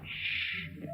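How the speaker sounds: ordinary delivery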